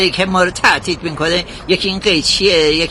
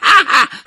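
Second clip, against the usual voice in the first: cheeky high laugh; 10 semitones higher, 4 dB louder than usual. Acted. Barber laughs at own joke.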